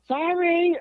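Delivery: very high, feeble-excuse mock apology; imitated old driver. Old driver apologizing through the car window, high mock apology. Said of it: "they were old people".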